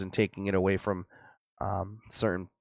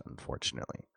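strained voice; 13 dB quieter, faster than usual. Real, not acted. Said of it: "My, uh, voice really starts doing a number on me".

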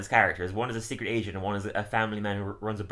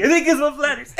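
laughing mock enthusiasm; 8 dB louder, faster than usual. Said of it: "that wouldn't look natural".

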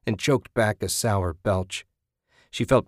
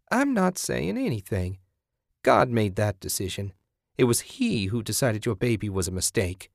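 pious, placating figure voice; self-righteous zealot; 2 semitones higher than usual. Acted. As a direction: play a sanctimonious woman excusing herself.

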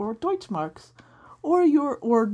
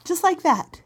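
ordinary talk

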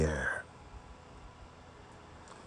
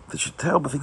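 normal talking voice

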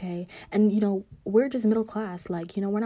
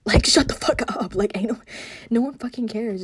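exasperated telling-off; 2 semitones higher, 6 dB louder than usual. Retold or imitated. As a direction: yell it as if shutting up the bullies. Telling off rich bullying kids.

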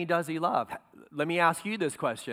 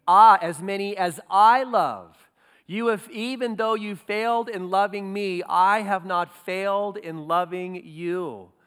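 Jesus's words, loud, with the I stressed; emphatic I; 2 semitones higher, 5 dB louder than usual. Acted. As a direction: press the I as Jesus pleads. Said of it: "the I is emphatic".

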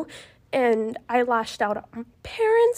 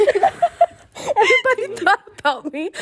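teary laugh; 9 semitones higher, 5 dB louder than usual. Real, not acted. Tearful laugh mid-confession.

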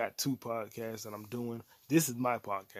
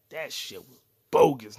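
loud rant; 13 dB louder than usual. Real, not acted. Ranting to viewers about the alien season, loud. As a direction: punch out bogus loud in disgust.

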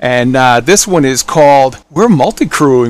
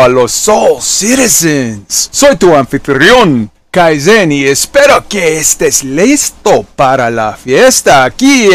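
booming Spanish ring announcer intro; lucha libre announcer; 2 semitones higher than usual. Pretend. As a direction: hype it up like a wrestling MC. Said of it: "No, I'm just playing with y'all".

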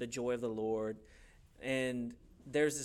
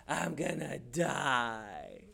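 long drawn-out dramatic wail; slow, melodramatic moan; mock despair; much slower than usual. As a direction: stretch it out as hammed-up doom. Acts Elijah despairing after the victory.

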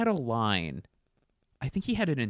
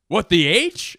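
mock Norm catchphrase, louder with a rising end; 8 semitones higher, 10 dB louder than usual. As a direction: say it as a goofy show tagline. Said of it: "Where he'd be like".

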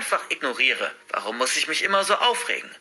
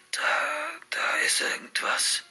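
breathless, halting whisper; panting whisper; 5 dB quieter, slower than usual. A narrator's reading. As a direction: gasp it out in a terrified whisper. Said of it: "flüstert er keuchend". (keuchend geflüstert)

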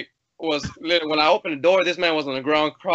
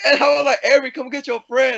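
yelling; 8 semitones higher, 4 dB louder than usual. Retold or imitated. Screaming at Avery, laughing.

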